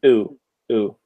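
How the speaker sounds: ooh with rounded lips and jaw almost closed; lips pursed. Shown as a demonstration. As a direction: purse the lips, keep the jaw nearly shut. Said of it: "your jaws are not open very wide".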